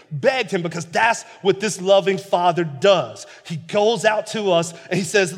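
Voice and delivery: fiery sermon reading; preaching at full force. Acted. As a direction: preach the story to the congregation.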